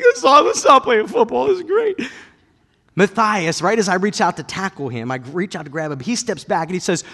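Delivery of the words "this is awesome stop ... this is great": much higher, loud, delighted exclamation; thrilled. Retold or imitated. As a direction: gush with joy at the moment. Father thrilled at the moment.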